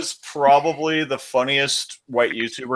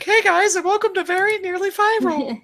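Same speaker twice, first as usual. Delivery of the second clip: much higher squeaky YouTuber voice. Retold or imitated. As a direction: imitate a squeaky intro voice. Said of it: "Roy's natural voice sounds like this".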